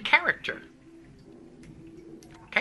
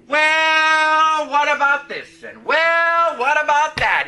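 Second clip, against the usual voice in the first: raised, drawn-out "well" in mimicry; mock nagging doubt; 5 semitones higher, 4 dB louder, slower than usual. Preacher voicing the doubting brain, high insistent nagging. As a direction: needle insistently, stretching each opener.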